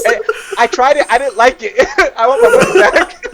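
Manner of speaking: high-pitched customer gripe breaking into laughter; mock complaint. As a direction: play the unhappy customer, laughing. Jokes about returning sixth sense.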